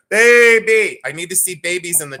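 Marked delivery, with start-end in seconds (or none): 0.1-1.0 higher, louder mock faith-healer scream; imitating a preacher's scream. Imitating a TV preacher screaming in someone's ear. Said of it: "scream in their ear".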